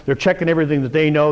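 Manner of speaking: normal talking voice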